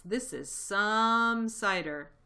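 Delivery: loud, slow amazement; gasped. A narrator's reading. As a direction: stretch it out, overwhelmed by the drink. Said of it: "gasped the smallest fox".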